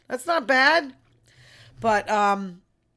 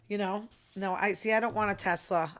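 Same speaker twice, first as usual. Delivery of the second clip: ordinary talk, nothing marked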